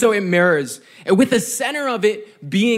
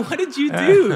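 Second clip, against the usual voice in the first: higher-pitched imitation of an appalled mom; mock dismay; 7 semitones higher, 3 dB louder than usual. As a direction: play mom's disbelief for laughs. Imitating mom finding badly dressed kids.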